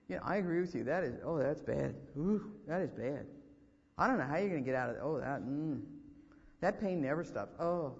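lightly acted gloomy agreement, pitched low; mock commiseration. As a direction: groan along like a discouraging visitor. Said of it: "you're not gonna sit and say".

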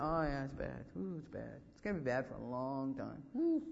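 low, softened doom-laden sympathy; mock commiseration. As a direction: play the visitor who sees no hope. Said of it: "I don't want you to tell me".

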